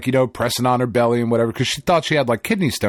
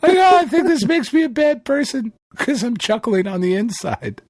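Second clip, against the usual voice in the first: high, put-on Teddy voice, chuckling guiltily; a high goofy cartoon handyman confessing; Teddy impression; 11 semitones higher than usual. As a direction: do Teddy from Bob's Burgers, guilty and amused. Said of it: "sounds like fucking Teddy when he's yelling on Bob's Burgers".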